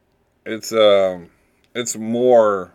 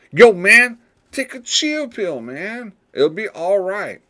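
much higher slang dude voice; slangy dude voice; 11 semitones higher than usual. Retold or imitated. Podcaster imitating a nineties dude calming a friend. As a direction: play a laid-back dude telling a friend to relax, high. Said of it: "You're like".

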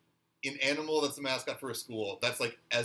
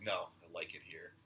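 lower, quieter, a hint of menace; a touch of hyena-Xander; flat creepy Xander; 8 semitones lower, 11 dB quieter than usual. Xander turning Willow down, lower and quieter. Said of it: "He's like".

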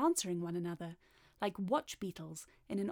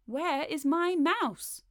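loud, high, drawn-out question; cat's demand; 7 semitones higher, 5 dB louder, much slower than usual. A narrator's reading. Cat who wants his supper asking the tailor, loud demand. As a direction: demand it slowly, with rising force.

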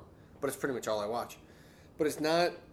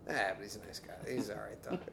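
soft, low, mock-kind afterthought; lightly faked niceness; buttered-up compliment; 4 semitones lower, 9 dB quieter, faster than usual. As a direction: sweeten the insult with a quiet compliment. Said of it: "And it's like".

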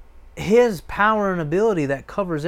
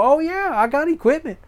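high, loud boastful show-off; hammed-up proud owner showing off; 6 semitones higher, 6 dB louder than usual. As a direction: brag about your gear, grinning. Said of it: "And you go".

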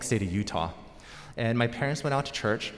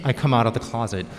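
ordinary talk, nothing marked

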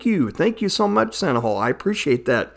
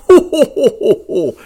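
high loud Santa belly laugh; jolly ho ho ho; 10 semitones higher, 6 dB louder than usual. Acted. Santa character laughing hearty.

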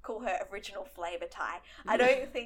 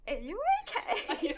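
concerned friend; 12 semitones higher than usual. Retold or imitated. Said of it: "this girl next to me is just like".

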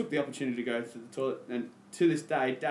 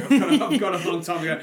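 laughing; 4 semitones higher, 10 dB louder than usual. Real, not acted. Laughing while telling sisters.

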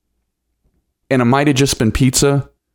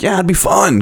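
higher, keen retold agreement; mildly excited kid's reply; eager child; 7 semitones higher than usual. Child agreeing to dad's circus offer, eager.

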